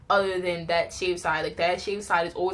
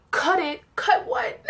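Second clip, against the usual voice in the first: high, loud shocked cry of disbelief; 10 semitones higher, 4 dB louder, slower than usual. Retold or imitated. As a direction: squeal it out in disbelief, higher on the second. Shocked reaction to haircut.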